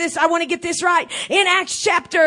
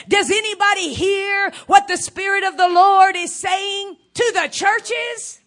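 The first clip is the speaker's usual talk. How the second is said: preaching shout; 5 semitones higher than usual. Real, not acted. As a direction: call it out to the church. Preacher calls out to congregation.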